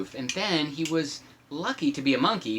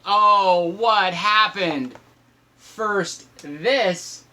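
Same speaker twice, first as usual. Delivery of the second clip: usual speaking voice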